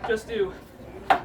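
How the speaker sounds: normal talking voice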